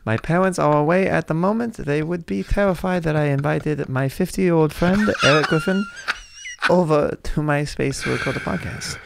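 put-on little kid voice, wobbly accent; eight-year-old kid. Kid host introducing a fifty-year-old friend, put-on child voice. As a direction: play a child podcast host earnestly. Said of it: "You went from an eight-year-old".

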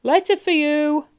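loud, high, slow holler; booming drawn-out call; shouting postman. A narrator's reading. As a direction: bellow the delivery. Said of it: "shouted the postman".

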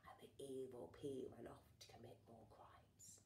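low whispered narration; sinister whisper. A narrator's reading. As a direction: whisper the villain's escape. Villain exits in story.

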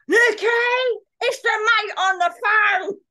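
high, loud drawn-out holler; mum yelling. Acted. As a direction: bellow for your child through the house. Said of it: "then she'd go".

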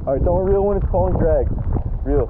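loud urgent call across water; shouting. Real, not acted. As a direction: yell it out to your buddy. Repeats warning to friend.

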